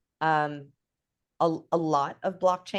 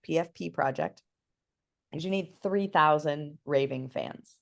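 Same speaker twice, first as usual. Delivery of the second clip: ordinary talk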